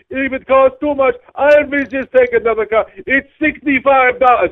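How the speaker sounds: high, slow, loud huffy ultimatum; entitled passenger. Retold or imitated. Passenger threatening the driver with another car, high and slow. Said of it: "She goes".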